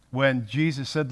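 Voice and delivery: ordinary delivery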